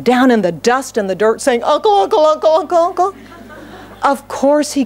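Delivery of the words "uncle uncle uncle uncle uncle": high-pitched, rapid-fire begging; frantic mock surrender. Jacob down in the dust, frantic mock surrender. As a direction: give in over and over without a gap, pitch up.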